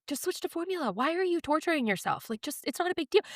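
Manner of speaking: high, soft, offhand advice; imitated outsider. Outsider questioning a struggling mother, imitated breezy dismissal.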